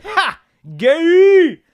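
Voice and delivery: high, loud drawn-out mocking cry; yelp, then squeal the taunt; schoolyard jeer. Shown as a demonstration. Demonstrating a late-nineties school taunt, high loud jeer. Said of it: "It goes".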